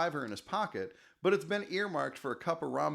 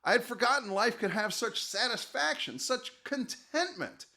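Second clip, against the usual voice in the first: awed hobo; 9 semitones higher than usual. Acted. Hobo in awe.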